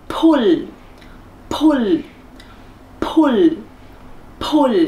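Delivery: "pull" with short, lax u and final l. Shown as a demonstration. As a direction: keep the vowel of "pull" short before the l. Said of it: "letter U được đọc là O".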